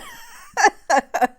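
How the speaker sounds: a loud high-pitched burst of laughter. Real, not acted. Laughs about fourteen-year-old son.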